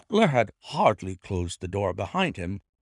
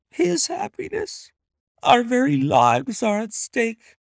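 much higher, desperate loving plea; Lady Hilda confessing to Holmes, much higher and rising; pleading lady; 9 semitones higher than usual. A narrator's reading. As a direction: beg for help, rising to the peak.

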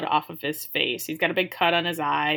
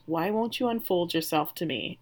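ordinary talk

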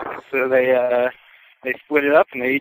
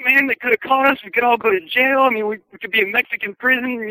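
high, loud panicked outburst; losing it; 12 semitones higher, 3 dB louder than usual. Retold or imitated. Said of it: "one of these guys just loses his shit".

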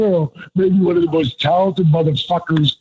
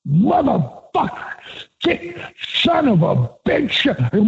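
higher, slow imitated cursing; Michael Hayes cursing; 8 semitones higher, slower than usual. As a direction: curse slowly and higher, imitating a friend in a bad mood. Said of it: "could do a dead-on Michael Hayes".

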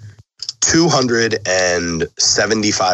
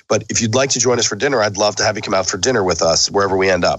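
normal talking voice

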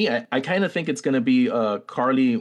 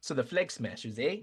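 put-on teasing Canadian accent, "eh" tacked on; lightly put-on Canadian accent with "eh"; mock Canadian accent; 10 dB quieter than usual. Ribbing his co-host about his Canadian-sounding accent. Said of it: "Canadian accent".

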